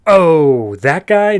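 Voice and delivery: loud, drawn-out realisation; startled recognition. Retold or imitated. Helena spotting her friend's old boyfriend, startled recognition. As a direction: blurt it out loudly on recognising him.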